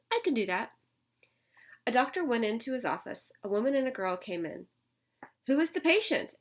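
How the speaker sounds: lively read-aloud storytelling voice; picture-book reading for young kids; story narration. A narrator's reading. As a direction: read it to the class with character voices.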